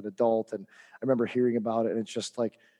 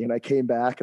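ordinary delivery

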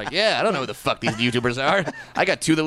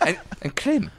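little lad voice; 11 semitones higher, 3 dB quieter than usual. Retold or imitated. Joining the berries jingle.

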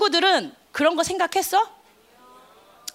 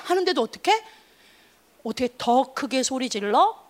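ordinary delivery

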